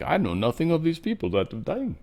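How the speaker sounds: put-on a rough stab at a South African accent. Host quoting Cyril Ramaphosa, in a mock South African accent. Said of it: "trying to do his South African accent".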